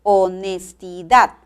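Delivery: "Honestidad" slowly sounded out, weight on final DAD; slow teaching demo of "Honestidad", final syllable stressed; last-syllable stress. Shown as a demonstration. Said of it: "la mayor fuerza de voz recae en la última sílaba".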